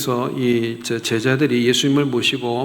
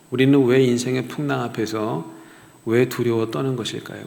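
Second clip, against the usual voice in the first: ordinary talk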